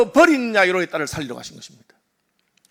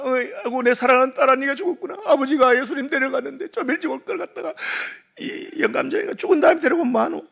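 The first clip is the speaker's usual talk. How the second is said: wailing lament, pitched up; mother wailing and blaming the late father; mock wailing mother; 5 semitones higher than usual. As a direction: play the grieving mother crying over her daughter. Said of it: "하고 막 울고 난린 거예요".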